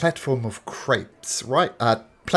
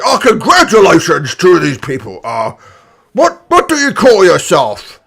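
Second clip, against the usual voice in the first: loud booming orc announcer; elderly orc; 7 semitones higher, 13 dB louder than usual. Acted. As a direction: call out to the crowd. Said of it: "the orc says".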